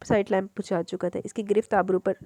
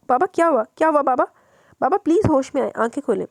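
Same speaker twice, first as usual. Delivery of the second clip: higher, louder alarmed pleading; panicked pleading; 6 semitones higher, 6 dB louder than usual. Acted. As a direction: try to wake him in panic. Daughter waking her panicked father. (ऊँची, घबराई हुई पुकार)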